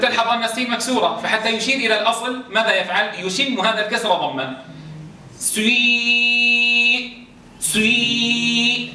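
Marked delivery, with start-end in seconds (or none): 5.4-8.9 سوي held very long with a u colour at the start gliding to i; drawn-out ishmam. Shown as a demonstration. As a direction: stretch سوي slowly, rounding the lips on the first sound. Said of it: "تسمع صوت الضمة في البداية". (إشمام في سوي بصوت ممدود ببطء)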